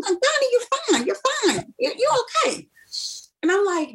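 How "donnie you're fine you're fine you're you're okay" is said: high-pitched mock reassurance; imitated dad, breezy and high; dismissive dad. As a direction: wave away her worry in a high voice. Imitating dad dismissing her worry.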